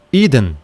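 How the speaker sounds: "idem" starting with a pure i; single-vowel start on "idem"; correct Greek sound. Shown as a demonstration. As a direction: say "idem" as EE-den. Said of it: "É idem".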